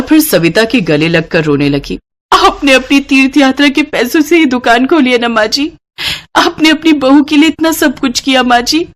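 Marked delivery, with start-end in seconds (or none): 2.3-8.9 put-on higher, emotional young woman near tears; tearful gratitude. Bride thanks mother-in-law, tearful and moved. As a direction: thank her through tears.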